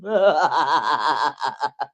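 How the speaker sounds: long rippling laugh; loud, drawn-out cackle. Real, not acted. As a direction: break up laughing after the tune. Laughs after singing.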